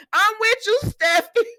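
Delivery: high, loud cheering on; loud, high "I'm with you"; excited agreement. Retold or imitated. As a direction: shout your support, pitched high. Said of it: "I said".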